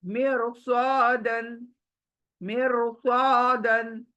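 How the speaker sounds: मिरसादन slowly, र said full; heavy ra, slow. Shown as a demonstration. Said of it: "रॉ साकिन है". (मिरसादन धीरे, र पुर)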